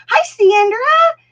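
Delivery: high, loud, drawn-out hello; excited greeting. Real, not acted. Greeting viewer Sandra mid-story, excited high call.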